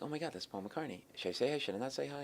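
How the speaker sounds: soft, starstruck self-questioning; hushed inner debate. Retold or imitated. Fan debates greeting star in hallway, hushed awe. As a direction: murmur it privately, as if awestruck.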